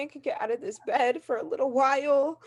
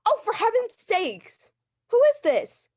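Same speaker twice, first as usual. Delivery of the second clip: high, loud and panicky; frantic alarm; 7 semitones higher, 6 dB louder, slower than usual. Acted. Invalid yelling at the mystery caller, panicked. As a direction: she is scared and demanding an answer.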